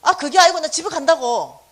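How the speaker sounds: loud, snappish brush-off; refusing the friend in a huff; mock irritated outburst; retold temper. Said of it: "왜 성질 내고 그러냐".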